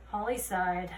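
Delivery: usual speaking voice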